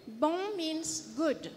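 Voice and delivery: ordinary talk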